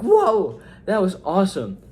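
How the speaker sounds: loud, high burst of amazement; excited. Retold or imitated. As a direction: blurt it out in awe. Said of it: "I was like".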